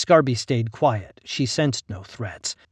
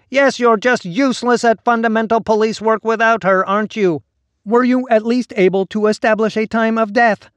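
whiny voice; 11 semitones higher, 9 dB louder than usual. A narrator's reading. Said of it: "a repellent whine".

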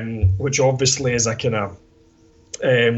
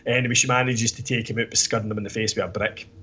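normal talking voice

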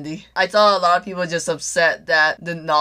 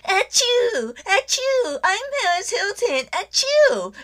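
squeaky high sneezing voice; high-pitched baby-voice sneezes; Paris Hilton impression; 14 semitones higher, slower than usual.

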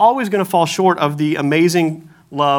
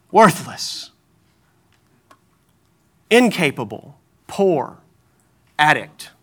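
accusing inner voice; 2 semitones lower, slower than usual. Pretend. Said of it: "a voice in your head that says".

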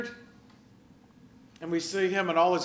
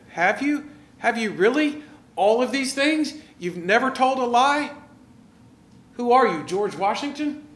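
higher and louder, teasing and incredulous; mock disbelief; 5 semitones higher, 4 dB louder than usual. Jesus needling the rich man who claims he kept every commandment, mock disbelief. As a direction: poke holes in his boast. Pastor mocks the man's claim.

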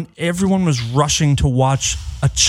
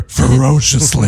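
mock deep voice; 3 semitones lower, 4 dB louder, slower than usual. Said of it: "his voice got deeper".

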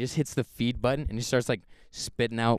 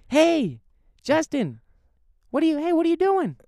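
very high, loud retold call; imitating the manager yelling at a kid; mock manager voice; 8 dB louder than usual. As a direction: call out from a bathroom stall. Manager voiced from bathroom stall.

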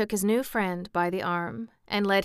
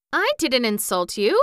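raised, louder note of indignation; indignant protest; 5 semitones higher, 8 dB louder than usual. A narrator's reading. Jack protesting to the king that he meant no insult, indignant. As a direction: protest your innocence, higher and louder. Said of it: "protested Jack indignantly".

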